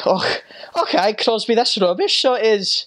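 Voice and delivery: high-pitched, playful Scottish brogue; Scottish accent. Acted. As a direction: tease in a put-on Scottish accent. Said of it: "that'd be the Scottish people".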